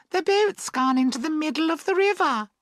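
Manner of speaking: put-on very high girlish surprise; surprised. A narrator's reading. Girls notice the boat in midriver, surprised. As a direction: exclaim in a high girl's voice. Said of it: "Then they stand up and are surprised".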